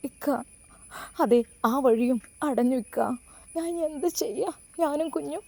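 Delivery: a heartbroken woman breaking down; crying softly to her absent husband; tearful plea. A narrator's reading. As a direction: voice rising high and soft through tears. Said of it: "ഹൃദയം പൊട്ടിക്കരഞ്ഞു".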